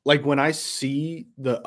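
usual speaking voice

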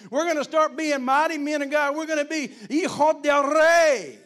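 high, loud, shouted preaching; preaching at full force. Acted. Preacher rallying the men in prayer, shouting at full force. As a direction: storm the heavens, full throttle.

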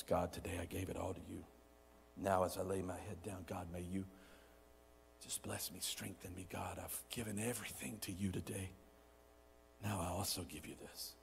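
low, soft prayer with pauses; bedtime prayer. Acted. Giving the day to God while lying down, softly prayed. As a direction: pray it quietly, winding down.

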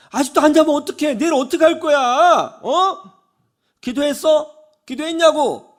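high-pitched, loud interrogation; nagging mother. Retold or imitated. Mother grills her son about bedtime and prayer, nagging. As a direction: pile on pointed scolding questions. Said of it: "잔소리를 막 쏟아내요".

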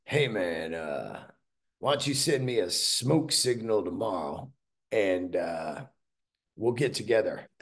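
low, relaxed impression of a bluesman buddy. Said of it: "But this is how he said. He goes".

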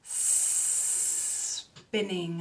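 SPINNING on a very long hissed S; long snake sound. Shown as a demonstration. Teacher has children say the word, long S. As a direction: draw out the first S of spinning. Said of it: "let's say the word".

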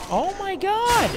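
very high, startled oh my God; shocked cry. Real, not acted. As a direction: shriek of disbelief. Shocked by the enemy.

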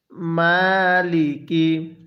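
very slow মালিকি with a long drawn "maa"; correct elongation. Shown as a demonstration. Teacher models the reading for learners, slow elongated মালিকি. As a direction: stretch the first vowel of মালিকি, level and measured. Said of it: "আমার সাথে পড়ুন".

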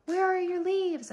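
high, drawn-out mock questioning; imitating boyfriend. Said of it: "he's like".

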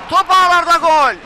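full-throated goal scream; screaming football commentator. Real, not acted. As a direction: roar the goal call. Live goal call on radio.

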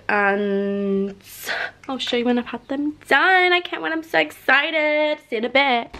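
normal talking voice

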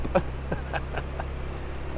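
quiet breathy unvoiced laughter; cracking up at your own joke; real laugh. Real, not acted. Laughing at his own made-up phrase, real laughter.

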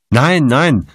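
high, urgent denial; protesting. A narrator's reading. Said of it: "widersprach Kottmann".

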